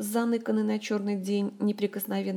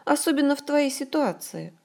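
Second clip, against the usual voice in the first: upbeat, higher-pitched afterthought; cheerful; 6 semitones higher than usual. A narrator's reading. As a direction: round it off chirpily. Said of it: "бодро подытожила подруга".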